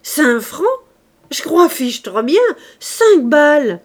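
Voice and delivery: loud, high, slowing at the end; a carter marvelling loudly at the price; loud guffawing carter. A narrator's reading. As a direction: bellow it like a jovial carter. Carter laughs at the offer.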